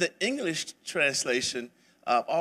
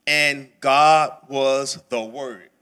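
"And God was the Word" slow and louder, word by word; word-for-word rendering; 8 dB louder, much slower than usual. Shown as a demonstration. Teacher finishes the literal Greek order, slow and emphatic. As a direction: lay out "And God was the Word" deliberately, each word weighted. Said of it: "That's what it says in the original language".

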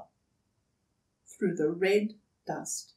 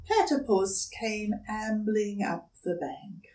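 plain reading voice

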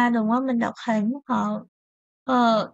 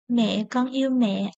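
ordinary talk, nothing marked

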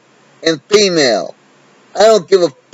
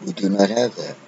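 soft echo; 6 semitones lower, 10 dB quieter, faster than usual. Acted. Solemn repeated line, echoed softly.

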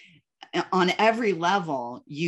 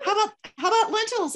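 much higher and fast mock coach; coach quoted; faster than usual. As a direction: fire it off as a teasing imitation. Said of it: "And you would be like".

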